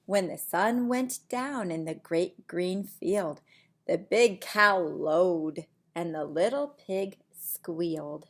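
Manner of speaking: lively storybook voice for little ones; reading to children. Acted. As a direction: read it like bedtime story time. Reads picture book aloud.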